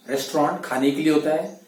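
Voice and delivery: ordinary delivery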